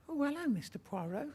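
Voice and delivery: lady with her shopping trolley greets Poirot, high and bright; old lady voice. Retold or imitated. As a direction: pipe up like a delighted old lady. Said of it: "and she says".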